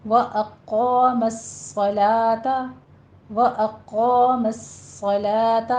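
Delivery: واقام الصلاۃ slowly with whistling, held ss; heavy qaa and firm saad in واقام الصلاۃ; measured chant, stretched vowels in واقام الصلاۃ; full heavy saad. Shown as a demonstration. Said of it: "پر پڑھا جائے گا".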